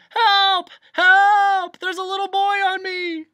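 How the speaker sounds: very high, loud panicked cries; shouting for help. A narrator's reading. Bee pinned under Milo, shouting for help. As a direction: wail frantically to be rescued. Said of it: "lay there shouting".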